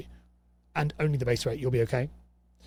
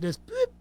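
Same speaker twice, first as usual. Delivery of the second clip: playful boop. Pretend. Network instantly blocking post.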